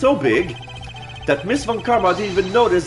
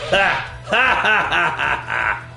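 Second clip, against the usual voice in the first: evil cackle; 2 semitones higher, slower than usual. Acted. Villain laughing at prosecutor.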